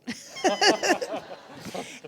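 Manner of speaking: high burst of real laughter; bright laugh. Real, not acted. Recalling asking what Obama said, real laugh. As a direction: let a real laugh break out. Laughs at her own question.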